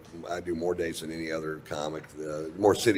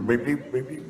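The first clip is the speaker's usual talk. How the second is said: backing-up beep; 7 semitones higher, 3 dB louder than usual. Retold or imitated. Imitating reversing yacht alarm.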